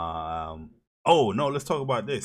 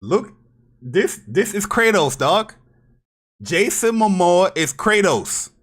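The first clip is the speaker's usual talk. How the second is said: shouting, pitched up, emphatic; loud insistent; 9 semitones higher, 10 dB louder than usual. Real, not acted. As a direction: yell it like you're sure. Insisting Momoa is Kratos.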